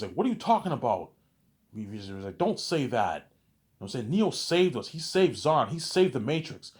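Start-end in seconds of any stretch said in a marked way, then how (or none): 0.1-1.1 higher and louder, offended; indignant outburst. Retold or imitated. V argues in retold quarrel. 2.4-3.1 louder and higher, cutting him off; sharp rebuke. Retold or imitated. V argues in retold quarrel. 4.1-6.7 high and loud, fervent; impassioned defense. Retold or imitated. V defends Neo in quarrel.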